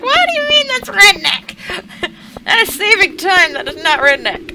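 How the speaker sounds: high, loud, laughing disbelief; high laughing indignation; incredulous protest. Retold or imitated. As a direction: argue the point through laughter. Arguing with coworker, laughing.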